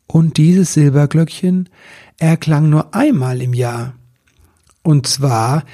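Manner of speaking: usual speaking voice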